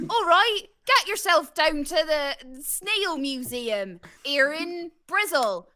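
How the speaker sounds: drawn-out, hammed-up Bristolian accent; exaggerated Bristolian. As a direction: improvise an advert in broad West Country. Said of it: "with that voice, please".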